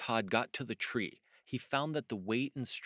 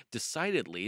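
usual speaking voice

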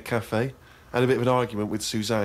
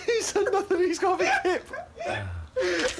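much higher, slow, wheezing laughter; laughing; much slower than usual. Real, not acted. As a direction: let the laugh stretch the words. Laughing at the diary.

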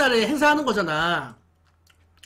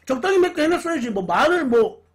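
eating between words; talking while chewing; 4 semitones higher, 3 dB louder than usual. Real, not acted. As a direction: mouth partly full of pizza. Seen 1: Holds pizza by mouth after biting; jaw appears busy while speaking.

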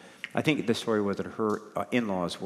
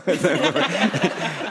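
a loud, scornful burst of laughter; mocking laugh; 8 semitones higher, 7 dB louder than usual. Mocking laugh before acted jeer.

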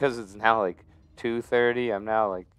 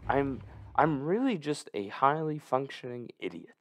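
normal talking voice

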